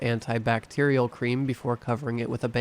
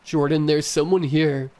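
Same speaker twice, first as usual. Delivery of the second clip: screaming; 4 semitones higher, 4 dB louder than usual. A narrator's reading. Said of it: "ran away screaming".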